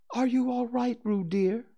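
anxious call. A narrator's reading. Kanga calls to Roo in the stream, mildly anxious. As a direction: call after the child with mild worry. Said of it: "called Kanga anxiously".

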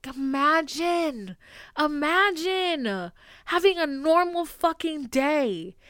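slow, drawn-out imagine; slow, dragged imagine; slow emotional plea. Real, not acted. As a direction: stretch it out, overwhelmed. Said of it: "it makes me wanna cry".

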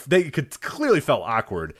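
ordinary talk

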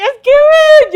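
high, loud hammed-up wail; mock crying. Retelling herself crying in the restaurant, mock wailing. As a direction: exaggerate your own tearful whine. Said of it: "yo llorando en el restaurante".

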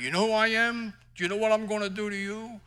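Italian tough guy. Retold or imitated. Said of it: "like an Italian giving an interview".

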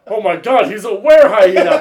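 loud, panicked Will Ferrell quote; mock alarmed shout. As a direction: shout it like a startled newsman. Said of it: "Will Ferrell's like".

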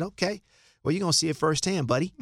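quick, high and confident reply; cocky comeback. Retold or imitated. Tim answers the defender's challenge with a cocky high reply. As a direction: fire back with a sure grin in the voice.

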